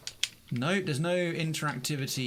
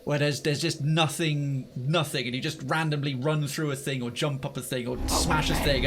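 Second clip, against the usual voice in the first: ordinary talk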